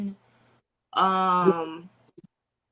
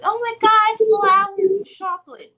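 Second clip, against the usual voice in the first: mock gushing; 10 semitones higher, 7 dB louder than usual. Mock gushing over romance.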